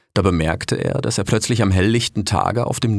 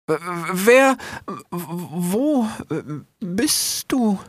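stammering fox; 10 semitones higher, much slower than usual. Acted.

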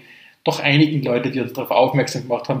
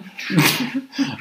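high laugh; 11 semitones higher than usual. Real, not acted. Laughing at closing joke.

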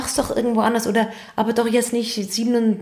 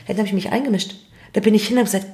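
ordinary talk

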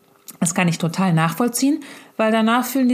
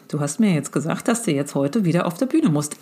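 usual speaking voice

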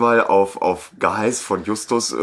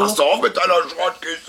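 angry yell; 9 semitones higher than usual. Retold or imitated. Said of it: "der sie da anmotzt".

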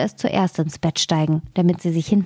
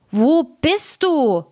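slow, much higher drawn-out call; witch calling out; 8 semitones higher, slower than usual. A narrator's reading. The witch calls out for her daughter, slow and high. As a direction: stretch the call out high and searching. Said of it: "Da rief sie noch einmal".

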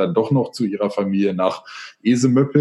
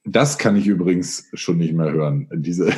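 ordinary talk

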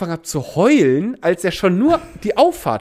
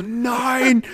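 high mock shout of no; retold shout; 7 semitones higher than usual. Seeing the scooter crash, a high dismayed shout. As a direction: cry out no in dismay. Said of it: "Und ich so".

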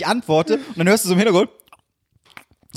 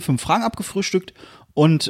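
normal talking voice

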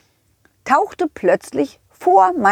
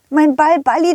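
usual speaking voice

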